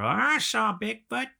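high, slow, hammed-up Southern drawl; mock a backwoods Bigfoot witness, stretched out and high; exaggerated Southern accent telling a Bigfoot sighting. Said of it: "people with that accent".

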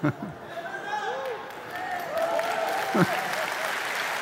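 long falsetto laugh at his own story; high breathy laugh. Real, not acted. Preacher to congregation, laughing high at the scene. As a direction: laugh up high and let it fall away. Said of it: "Não é assim".

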